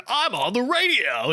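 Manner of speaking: upbeat on-air radio announcer voice. Shown as a demonstration. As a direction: punch it like a radio host.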